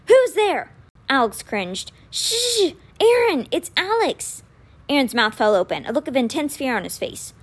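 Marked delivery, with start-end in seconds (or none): 0.1-0.7 high, loud frightened cry; boy jolted awake yells at the dark; startled shout. A narrator's reading. Woken boy shouts at an intruder, frightened. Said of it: "He let out a shout". 2.1-4.4 urgent hush. Acted. Alex hushing his panicked brother.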